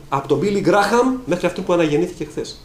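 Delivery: ordinary delivery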